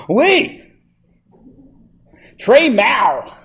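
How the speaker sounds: loud, high-pitched mock French reply; exuberant bad French. Teacher replaying comeback to student who hates their French, loudly hammed-up. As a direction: belt out gleefully awful French, swooping high.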